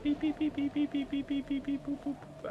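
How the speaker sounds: very high, monotone run of beeps; high robotic beeps dropping off into boops; imitated electronic beeping. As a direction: beep like a little machine, all on one high note. Imitates electronic beeps while playing.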